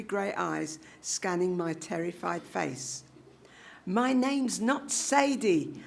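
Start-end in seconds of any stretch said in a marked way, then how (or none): 3.9-5.8 slow, higher protest; a scared girl insisting on her name; insistent. A narrator's reading. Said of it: "I said".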